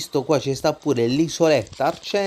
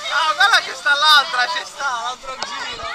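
excited on a ride; 13 semitones higher than usual. Real, not acted. On the log flume ride.

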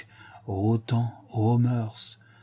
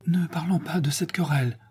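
higher, breathless half-whisper; with emotion; 7 semitones higher than usual. A narrator's reading. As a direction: brush it aside, moved. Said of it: "répondit-il avec émotion". (murmure ému, presque chuchoté)

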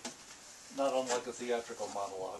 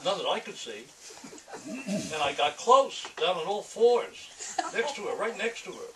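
louder, higher, laughing through the lines; laughing loud reading; 7 semitones higher, 8 dB louder than usual. Acted. Author reading his noir comic to an audience, laughing and loud. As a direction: push it up, cracking up as you go.

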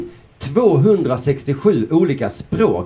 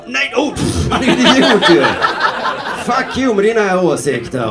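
high, loud dismissive outburst; hammed-up angry heckle at the opponent; mock outrage; 7 semitones higher, 3 dB louder, slower than usual. Debate viewer turning on the speaker, mock outraged.

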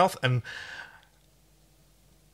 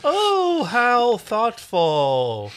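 sarcastic; 9 semitones higher, 4 dB louder, much slower than usual. Acted. Sarcastic reply to gift.

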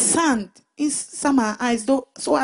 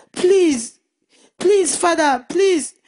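louder, begging please after please; a child's retold begging, louder and higher; desperate pleading; 4 semitones higher, 5 dB louder, much slower than usual. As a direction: plead with your father, voice rising. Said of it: "I said".

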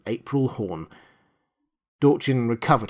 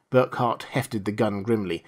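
usual speaking voice

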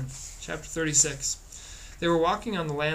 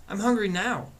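put-on higher, insistent childish complaint; insistent whine; 6 semitones higher than usual. A narrator's reading. Boy demands food after being told to wait, whining insistently. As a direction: whine it, pitched well up.

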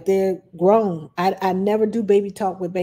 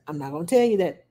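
usual speaking voice

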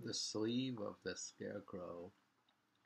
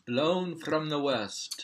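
slow, high and loud proclamation; loud dramatic reading; 8 semitones higher, 11 dB louder, slower than usual. Shown as a demonstration. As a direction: declaim it grandly and slowly. Reading haiku aloud.

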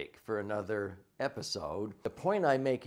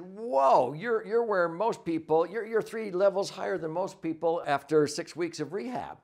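higher and louder, amazed; flabbergasted; 6 semitones higher, 4 dB louder than usual. Retold or imitated. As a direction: voice the staff's astonishment. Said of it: "they were flabbergasted".

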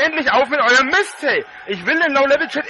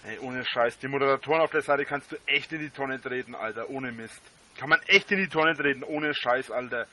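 muttering; 9 semitones lower, 8 dB quieter than usual. Real, not acted. Muttered rant about the mods.

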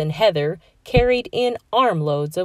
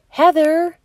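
high, louder sing-song call; calling sister; 11 semitones higher, 5 dB louder than usual. A narrator's reading. Robbie waking his sleeping sister, sing-song call. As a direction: coax her awake with a rising call.

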